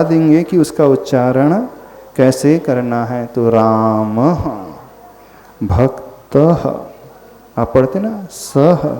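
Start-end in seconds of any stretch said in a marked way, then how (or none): 3.2-6.7 "रामः" and "भक्तः" slow with a soft trailing h; visarga h. Shown as a demonstration. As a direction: draw out "भक्तः" ending in a faint h echo. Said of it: "extra थोड़ा सा ह". (धीमे से "रामः, भक्तः" विसर्ग के साथ)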